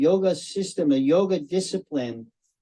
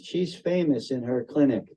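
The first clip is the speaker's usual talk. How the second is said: ordinary delivery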